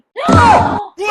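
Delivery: sharp, high, loud gasp; a loud startled gasp; shocked gasp. Acted. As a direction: gasp in alarm as she threatens to leave. Mother-in-law shocked at leaving.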